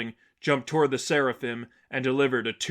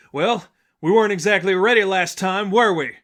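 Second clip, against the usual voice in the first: a raised, louder voice, higher, over the clash; speaking over a duel; 7 semitones higher, 8 dB louder than usual. A narrator's reading. Blackhawk arguing while dueling an ox, raised and loud. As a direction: push it out over the noise of a fight. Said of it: "Blackhawk spoke over her own duel".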